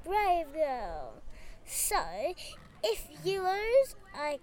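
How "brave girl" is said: ordinary delivery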